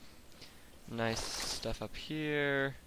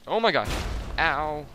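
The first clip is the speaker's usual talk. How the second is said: startled yelp; 4 semitones higher, 10 dB louder than usual. Real, not acted. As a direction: shout of shock, louder and higher. Stepped on a mine.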